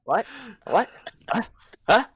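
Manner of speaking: short, repeated baffled questions; stammering confusion. Acted. Turning back perplexed, stammering in confusion. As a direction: get stuck on one word, perplexed. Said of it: "stammering over my thoughts".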